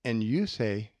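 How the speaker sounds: normal talking voice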